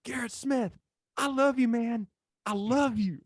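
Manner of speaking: high imitated yell from far off; mock-yell it like a buddy calling across the parking lot; imitating a friend's distant shouted greeting; retold scream across a lot. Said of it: "I hear this voice scream across the parking lot".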